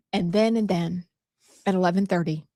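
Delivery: usual speaking voice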